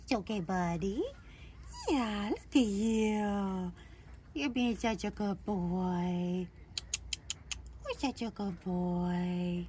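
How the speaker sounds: slow, gentle reassurance; soothing a scared dog. Real, not acted. Calming puppy during handling.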